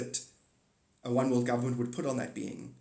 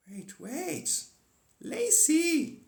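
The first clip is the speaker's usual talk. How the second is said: excited high call; much slower than usual. Real, not acted.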